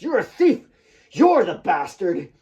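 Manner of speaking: furious shout. Acted. Claire pounding Nick's chest, shouting furiously. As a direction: scream it while hitting him.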